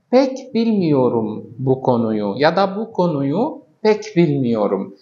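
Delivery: each word drawn out slowly; slow example sentence. Shown as a demonstration. Teacher reading a Turkish example to learners, slowly. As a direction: read the example slowly for the class. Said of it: "Misal آخر".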